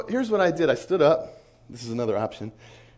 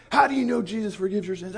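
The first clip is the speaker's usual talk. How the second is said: higher-pitched demand, imitating a screaming man; mild mock yell; 6 semitones higher than usual. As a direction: retell the yelling without fully shouting. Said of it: "he's screaming and yelling about it".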